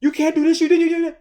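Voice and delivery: high mocking accusing voice; mock rant. Stranger accusing wife, high mocking rant. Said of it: "He's going off on my wife".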